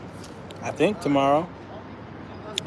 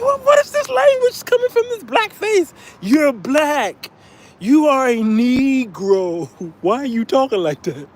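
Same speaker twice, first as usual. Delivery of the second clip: much higher, astonished falsetto; stunned disbelief at the accent; incredulous; 8 semitones higher than usual. Retold or imitated. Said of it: "I'm like".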